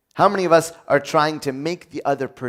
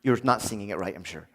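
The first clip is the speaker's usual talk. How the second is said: lower, soft mock wife; wife's quiet voice; 7 semitones lower, 10 dB quieter, faster than usual. A soft dismissive voice, imitating his wife's reply.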